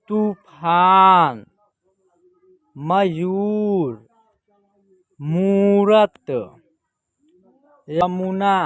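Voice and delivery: slow word list, long vowels held, pauses between words; teacher sounding out single words; drawn-out model reading. Shown as a demonstration. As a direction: stretch each long vowel.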